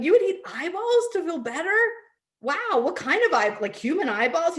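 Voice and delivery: high, loud playful disbelief at the eyeballs; mock astonishment. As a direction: play up the shock for the kid. Mother playing along with son.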